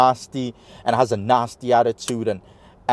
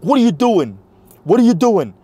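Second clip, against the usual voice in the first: much higher, louder repeated demand; raised-voice retelling of an angry challenge; imitated yelling; 10 semitones higher, 6 dB louder than usual. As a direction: mimic someone yelling in confrontation. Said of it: "starts yelling at me".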